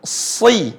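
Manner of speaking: Si said heavy, not a thin I; thick si. Shown as a demonstration. Teacher models heavy si for students. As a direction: darken the vowel of Si. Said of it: "So bagaimana?".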